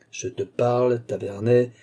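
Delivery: plain reading voice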